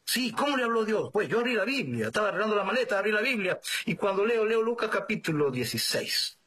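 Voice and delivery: high-pitched, eager account; excited retelling. Retold or imitated. Man tells the priest how God spoke, excited.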